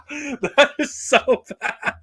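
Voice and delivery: high, slow, laughing words; talking through laughter. Real, not acted. Fan admitting a loved movie is awful, laughing hard.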